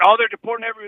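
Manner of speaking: high, loud imitated panic; mock alarm. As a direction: mimic people overreacting in alarm. Mimics alarmed people, then rebuts.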